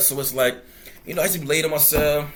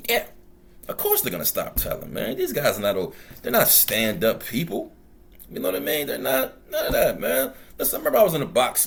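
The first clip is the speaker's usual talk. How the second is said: ordinary talk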